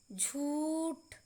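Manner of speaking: झूठ said slowly with a held oo; long u. Shown as a demonstration. Teacher modelling long vowels for learners, stretched oo in झूठ. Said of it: "अधिक समय लगता है".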